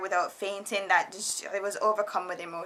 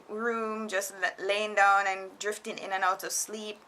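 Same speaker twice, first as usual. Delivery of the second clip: usual speaking voice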